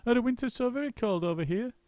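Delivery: high, chattering monkey; a monkey's quick squeaky voice; Muggle Wump. A narrator's reading. Muggle Wump questioning the roly-poly bird.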